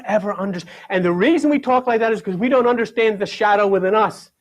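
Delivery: ordinary talk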